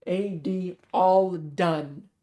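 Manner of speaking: slow and deliberate. Acted. Coded message over the phone.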